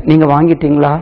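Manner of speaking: ordinary talk, nothing marked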